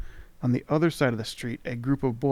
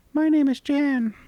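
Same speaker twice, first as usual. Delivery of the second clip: much higher, squeaky sprite voice; charming tiny creature; 14 semitones higher, 6 dB louder than usual. Acted. Tiny creature charmingly greeting the traveller. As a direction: chirp it like a tiny fairy. Voicing the mini mite.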